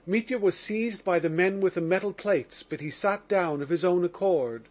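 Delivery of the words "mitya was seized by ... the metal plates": ordinary delivery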